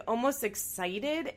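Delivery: ordinary talk, nothing marked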